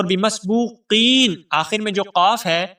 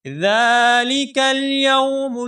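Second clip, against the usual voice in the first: slow, melodic tajweed chant; Quran recitation; 3 semitones higher, much slower than usual. Shown as a demonstration. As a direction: recite it drawn out like a qari. Recites verse. (تجوید کے ساتھ تلاوت)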